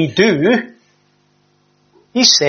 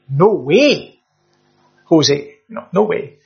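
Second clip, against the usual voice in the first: casual no way; 4 semitones lower, slower than usual. Pretend. Preacher giving Paul's answer in street slang, playful. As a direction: toss it off like an everyday refusal. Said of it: "we might colloquially say something like".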